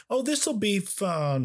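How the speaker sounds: high, drawn-out mock optimism; hopeful. Critic who was rooting for the movie, hopeful. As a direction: act out wishful anticipation, pitched high and slow. Acts out own hopes while watching.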